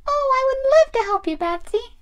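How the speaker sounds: high, quick, eager doll voice; Peach. Pretend. Doll eager to help her friend, high and keen. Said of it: "Peach wants to help you".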